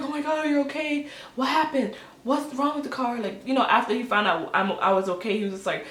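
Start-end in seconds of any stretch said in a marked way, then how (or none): 0.0-3.2 higher, quick alarmed questions; acting out a concerned husband; pitched-up worried questioning; imitated worried husband.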